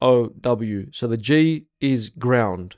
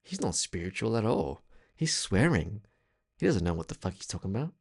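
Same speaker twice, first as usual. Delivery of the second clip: quiet, fast sneer from an imagined critic; a hushed, rapid imitation of a cynical listener; mocking skeptic; 4 semitones lower, 10 dB quieter than usual. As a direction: mutter the doubter's scorn, rattled off quickly. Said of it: "someone literally listening to me thinking".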